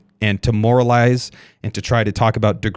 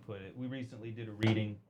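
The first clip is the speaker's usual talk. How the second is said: muffled, mouth pressed into elbow; talking into elbow; 20 dB quieter than usual. Real, not acted. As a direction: speak into your sleeve, muffled. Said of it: "Sorry, I'm talking into my elbow".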